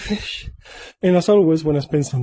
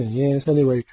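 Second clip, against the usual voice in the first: usual speaking voice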